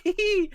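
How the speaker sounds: very high, loud "hee hee"; squealed acted titter; gleeful giggle. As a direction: giggle like a manic little figure. Shah crawling gleefully over the Titan.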